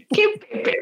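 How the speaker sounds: high, baffled ¿Qué Pepe?; puzzled question. Retold or imitated. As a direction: imitate a confused colleague. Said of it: "me dice".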